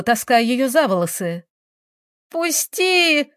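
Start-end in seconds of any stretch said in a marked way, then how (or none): 2.3-3.3 high, pained cry; cried out. A narrator's reading. Girl dragged by her hair, high cry. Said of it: "закричала Таисия".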